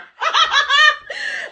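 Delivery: high, loud mocking laugh; gloating cackle. Real, not acted. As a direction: cackle at his misery. Gloats over his crying.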